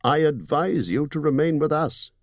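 高めの声でゆっくり威厳ある呼びかけ; 敬意をこめて名を呼ぶエルフの王. Acted. Elvenking voiced in story.

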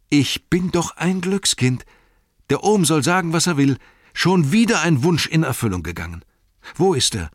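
high, loud, jubilant outburst; overjoyed. Acted. Sam rejoices over his pony's return, overjoyed. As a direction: crow over unbelievable good luck.

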